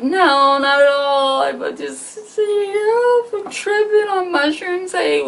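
high, crying, wavering explanation; tearful pleading. Retold or imitated. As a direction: sob out your excuse.

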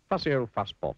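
high, lively taunt; teasing name-calling. A narrator's reading. Narrator voices people's teasing nickname.

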